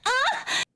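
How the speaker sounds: sudden high yelp of fright; startled yelp. Real, not acted. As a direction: jump and yelp in surprise. Sudden startle mid-sentence.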